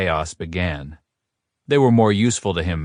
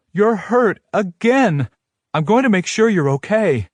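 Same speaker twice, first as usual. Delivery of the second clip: put-on worried girlfriend; 12 semitones higher, 4 dB louder than usual. A narrator's reading. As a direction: insist on caring for him.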